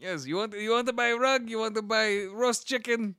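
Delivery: high-pitched Arabian street seller; Arabian market trader. Acted. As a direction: tout your wares in an Arabian accent. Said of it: "he's like an Arabian market trader".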